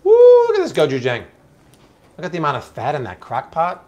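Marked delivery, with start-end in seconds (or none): 0.0-1.2 excited. Real, not acted. Host excited by dog's bowl.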